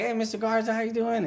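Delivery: high, chirpy mock phone greeting; singsong happy hello; happy caller. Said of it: "she sounded happy".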